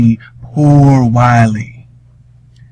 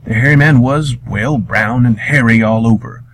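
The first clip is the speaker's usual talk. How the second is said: ordinary delivery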